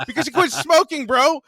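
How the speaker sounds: high, loud, laughing praise; doctor cheering the patient on, excited and laughing. Retold or imitated. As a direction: sell it like great news, laughing. Doctor happily praises quitting, retold.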